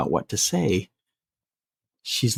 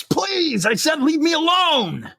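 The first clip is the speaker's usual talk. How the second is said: high-pitched panicked scream; slower than usual. Retold or imitated. Trapped woman begging a man in the elevator, screaming. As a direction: scream the plea, pitch shooting right up. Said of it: "she screams".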